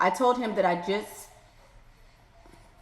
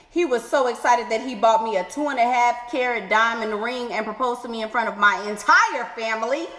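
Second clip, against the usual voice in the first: usual speaking voice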